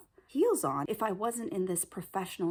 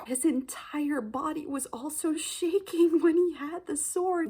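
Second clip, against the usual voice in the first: ordinary talk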